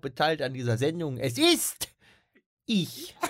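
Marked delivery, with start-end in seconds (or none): 1.2-3.2 hammed-up announcement. Hammy self-introduction.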